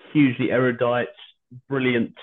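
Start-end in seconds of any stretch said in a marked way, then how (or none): none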